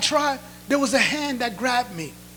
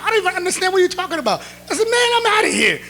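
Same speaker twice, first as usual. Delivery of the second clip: fast, much higher and louder protest; indignant outburst; 8 semitones higher, 4 dB louder, faster than usual. Retold or imitated. Young man refusing baptism at the altar, fast high protest. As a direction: blurt it out and bolt.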